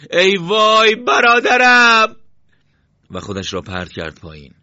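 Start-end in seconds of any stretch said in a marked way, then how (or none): 0.1-2.2 very high, drawn-out cry of grief; loud, stretched scream for a lost brother; anguished shout. Acted. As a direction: wail out slowly at full voice. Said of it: "بعد داد زد".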